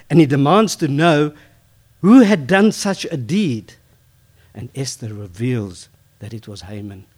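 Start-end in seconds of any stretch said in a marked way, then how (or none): 0.1-3.7 higher, louder, rising outrage; furious demand. A narrator's reading. Preacher telling of the furious king, loud and rising. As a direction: build the king's fury into the question.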